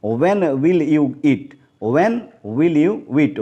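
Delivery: very slow, careful reading; slow model sentence. Shown as a demonstration. Teacher reads the question slowly to the class. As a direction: lay each word out for learners. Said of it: "when नहीं".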